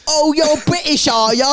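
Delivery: high mock Cockney accent; Cockney accent, teasing a Brit. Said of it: "the most scum working class accent you can do".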